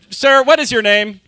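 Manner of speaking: normal talking voice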